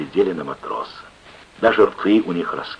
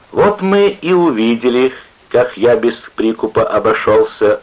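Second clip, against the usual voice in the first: higher, self-satisfied declaration; very pleased announcement; 5 semitones higher, 5 dB louder than usual. A narrator's reading. Clerk to fellow players after winning, very pleased. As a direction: announce your win with satisfaction. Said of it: "объявил он весьма довольный".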